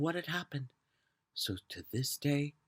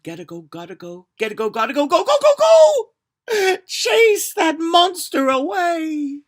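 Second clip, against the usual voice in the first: panicked cry rising far higher and louder; terrified shout; 13 semitones higher, 14 dB louder than usual. Acted. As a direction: scream it in terror. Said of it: "He shouted".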